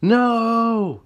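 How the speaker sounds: high, loud desperate yell; Johnny yelling. Acted. Johnny shields Tessa from the rifle, yelling high. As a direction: cry out to save her. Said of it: "Johnny yells".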